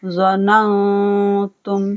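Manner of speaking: ज़ननतुम with a hummed, hidden nun; slow recitation with ghunna; nasal ghunna. Shown as a demonstration. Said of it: "इख्फा किया जाएगा गुन्ना के साथ".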